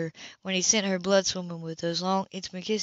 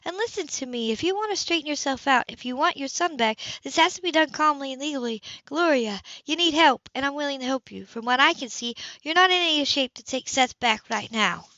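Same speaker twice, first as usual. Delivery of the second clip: higher, controlled and insistent; firm older sister; 7 semitones higher than usual. Acted. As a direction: lay out the terms steadily. Sybil's voice, pitched higher.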